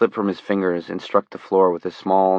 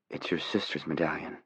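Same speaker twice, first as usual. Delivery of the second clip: quiet; 2 semitones lower, 11 dB quieter than usual. Acted. Johnny speaks quietly to Dussault.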